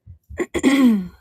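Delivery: a loud rough throat clear. Real, not acted. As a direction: clear your throat hard. Hoarse voice.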